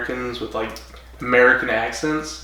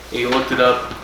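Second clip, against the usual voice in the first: normal talking voice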